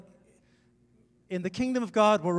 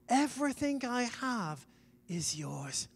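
tender promise; 4 semitones higher, 3 dB quieter, slower than usual. Acted. Father's promise, dropping to soft.

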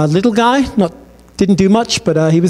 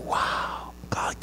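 softer whispered wonder; awed whisper; 18 dB quieter than usual. Retold or imitated. Friends whispering in the barbershop as God arrives, awed whisper. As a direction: breathe it like you're in a holy place.

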